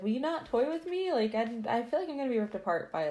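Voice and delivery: high, rushing mock plea; mock pleading. Mobbed man begging the Marquis for help, mock pleading. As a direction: plead in an exaggerated high pitch.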